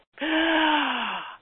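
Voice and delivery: sharp, high, loud gasp for breath; newborn's first sharp intake of breath, high and loud; imitated gasp. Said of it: "And he went".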